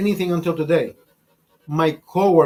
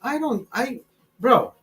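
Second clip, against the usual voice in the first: ordinary delivery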